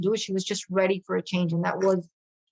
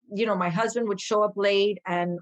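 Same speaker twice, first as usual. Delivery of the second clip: ordinary talk, nothing marked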